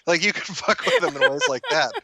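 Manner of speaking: high, laughter-filled voice; laughing while talking. Real, not acted. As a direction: crack up through the words. Cracking up at his prank idea.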